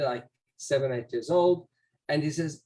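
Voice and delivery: ordinary delivery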